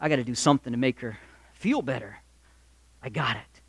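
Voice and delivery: low, very soft musing to himself; lightly acted private thought, sinking low; husband thinking. Husband wondering how to cheer his wife, quiet musing. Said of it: "So he's saying".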